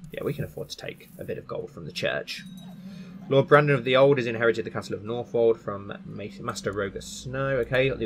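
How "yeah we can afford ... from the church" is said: whispered aside to himself; breathy whisper, no voice. Shown as a demonstration. Repeats same phrase, unvoiced.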